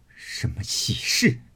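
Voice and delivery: slow, crying sneer; tearful scorn. Acted. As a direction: sneer through tears. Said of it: "一面说，一面哭". (哭着冷笑)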